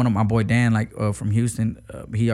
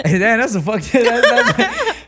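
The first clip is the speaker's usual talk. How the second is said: high, loud laughter breaking up the words; cracking up; 13 semitones higher, 6 dB louder, slower than usual. Real, not acted. Rapper cracking up with the interviewer over a fumbled answer, laughing. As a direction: laugh helplessly while trying to talk.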